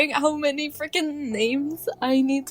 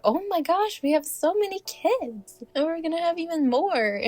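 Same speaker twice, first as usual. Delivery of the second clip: a lightly voiced, thrilled dad, pitched higher; excited husband; 7 semitones higher than usual. Husband told of the new baby, lightly voiced high excitement. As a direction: play the husband gushing over more kids. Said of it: "He's like".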